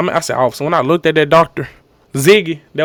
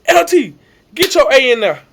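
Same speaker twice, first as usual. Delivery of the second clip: lightly voiced coach, higher pitched; coach's order; 7 semitones higher than usual. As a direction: imitate a coach sending a player in. Quotes coach ordering him in.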